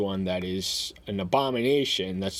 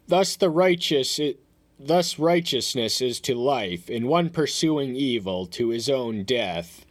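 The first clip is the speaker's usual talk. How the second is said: higher, louder, solemn proclamation of the verse; scripture reading; 5 semitones higher, 4 dB louder than usual. Acted. Reads scripture aloud.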